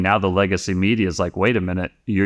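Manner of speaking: usual speaking voice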